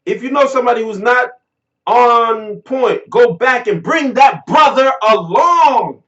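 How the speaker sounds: loud, emphatic reading of a quote; rousing quotation. Retold or imitated. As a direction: declaim it with punched words. Reads out rousing speech quote.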